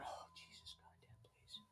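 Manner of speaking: frantic whispered prayer; whispered plea. Retold or imitated. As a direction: breathe it under your breath, begging. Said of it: "my daughter's back there going".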